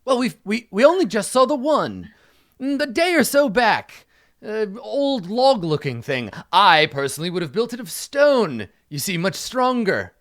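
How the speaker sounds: high, loud, drawn-out knight voice; pompous knight. Acted. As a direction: boast grandly about your better judgment.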